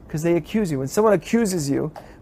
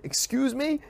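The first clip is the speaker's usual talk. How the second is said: mock offended challenge; indignant excuse me; 5 semitones higher, 8 dB quieter than usual. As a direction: excuse me with a rising, affronted lilt. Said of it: "he says to Moses".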